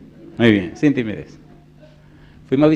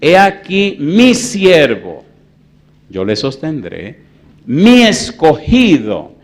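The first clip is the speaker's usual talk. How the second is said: proclaiming scripture; 6 semitones higher, 12 dB louder, much slower than usual. Real, not acted. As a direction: declaim the verse in stretched, ringing phrases. Proclaims God's words. (proclamación bíblica lenta y potente)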